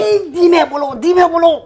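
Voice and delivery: loud panicky shush; ironic loud hush. Acted. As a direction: hush them loudly, missing the irony. Urging others to speak softly.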